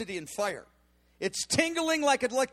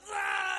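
high, drawn-out mock wail of travail; imitated groaning; 9 semitones higher, 3 dB quieter than usual. Preacher re-enacting his travail into the pillow, wailing groan. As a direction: imitate a cry let out into a pillow. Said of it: "I'm just groaning and travailing".

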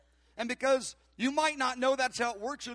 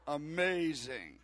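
awed; 8 semitones lower, 3 dB quieter, much slower than usual. Retold or imitated. His awed reaction to stranger.

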